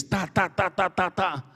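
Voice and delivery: quick clipped hiccup noises; imitated hiccups. Man showing how his hiccups went, mock hiccuping. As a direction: tap out the hiccups with the voice. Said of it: "soluço".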